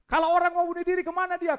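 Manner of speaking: high, loud and fast preaching cry. Acted. Preacher repeating to the congregation, fast loud shout.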